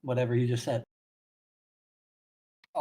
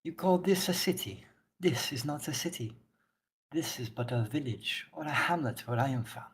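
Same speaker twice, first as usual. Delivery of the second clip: put-on soft, wobbly go at a Wakanda accent; lightly put-on Wakanda accent; attempted Wakanda accent; 9 dB quieter than usual. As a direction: attempt Okoye's Wakanda accent, not quite consistent. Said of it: "I don't remember what accent she had".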